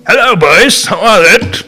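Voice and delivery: hearty greeting. Retold or imitated. Godbrother greeting drinkers in bar.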